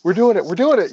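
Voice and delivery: high, eager excitement that the fire is real; thrilled rush. Retold or imitated. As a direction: rookie buzzing at his first real fire. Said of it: "and you're like".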